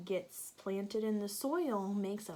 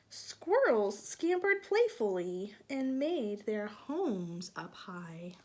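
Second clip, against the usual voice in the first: bright, lilting storybook narration, a bit louder; reading to children; 3 semitones higher, 6 dB louder than usual. A narrator's reading. Teacher reading a picture book to children, lilting storybook voice. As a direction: draw the little ones into the story.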